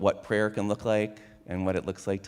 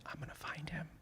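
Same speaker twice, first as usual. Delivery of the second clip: whisper; 8 semitones higher, 19 dB quieter, faster than usual. Acted. Litvak resolves to spy.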